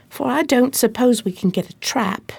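ordinary talk

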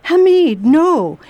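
sudden loud high cry of dismay; horrified cry. Acted. Nurse crying out as Hamid reveals his plague sores. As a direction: she refuses to believe it, crying out his name.

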